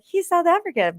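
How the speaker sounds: lightly voiced, high-pitched claim; high mock-casual quip. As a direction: toss it off high and breezy on "South African". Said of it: "I'll be like".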